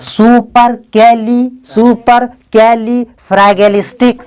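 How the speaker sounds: सुपर कैली फ्रैगेलिस्टिक sounded out slowly, each syllable held; teacher's slow model reading of सुपर कैली फ्रैगेलिस्टिक. Shown as a demonstration. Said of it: "इस word को syllable में तोड़ेंगे".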